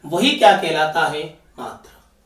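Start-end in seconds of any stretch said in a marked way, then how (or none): 1.6-2.1 breathy answer mouthed to the class; teacher whispering the answer; whispered prompt. Shown as a demonstration.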